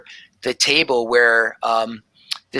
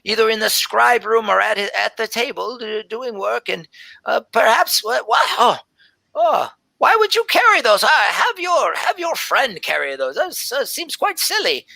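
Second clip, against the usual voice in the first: put-on high, fluttery scolding from an old lady; a much higher, doddering elf lady, bossy but kind; fussing old elf scribe; 8 semitones higher than usual. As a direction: fuss over the little one in a shrill old voice. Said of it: "she says".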